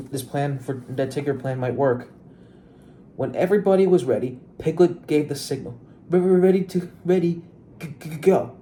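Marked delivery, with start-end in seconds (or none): 6.1-8.6 raised, higher voice, stuttering toward the command; a mild stuttering shout, as a story character; stammered shout. A narrator's reading. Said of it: "shouted Piglet".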